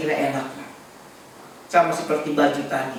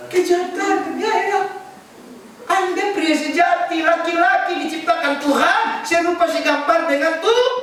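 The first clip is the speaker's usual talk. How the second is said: high, ringing preacher's voice; insistent sermon delivery, pitched well up; emphatic preaching; 12 semitones higher than usual. Real, not acted. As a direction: exhort the congregation at a high pitch.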